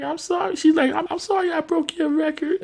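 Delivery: put-on high, small childlike voice; kid apology. Brianna apologizing to Bernie, high and small. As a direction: apologize like a timid little girl.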